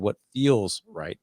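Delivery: "what feels right" said slowly and deliberately; slow emphasis. Shown as a demonstration. Parent showing the exact phrase he uses with kids. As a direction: lean into "what feels right," spelling out each word. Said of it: "I use that word".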